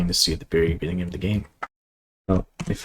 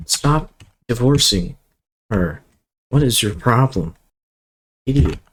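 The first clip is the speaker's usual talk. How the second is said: annoyed scolding; 5 semitones higher, 5 dB louder than usual. Real, not acted. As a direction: scold the character, fed up. Scolding a game character.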